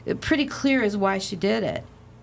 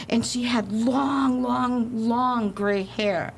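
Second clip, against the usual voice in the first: spooky storytelling; 4 semitones higher, much slower than usual. Retold or imitated. Spooky ghost description.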